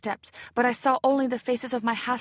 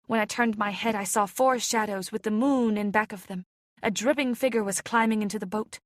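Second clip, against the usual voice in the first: usual speaking voice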